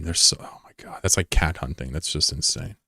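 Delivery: a hushed whisper of disgust; appalled whisper. Real, not acted. Streamer appalled that people hunt raccoons, whispering. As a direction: breathe it out in horror.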